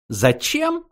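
very high rising question; testing question. A narrator's reading. Frankie trying the word as the ultimate question. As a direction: try the word out quizzically.

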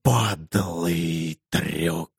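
low, slow reptilian hiss; lizard hiss. Acted. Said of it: "прошипел достойным ящера голосом".

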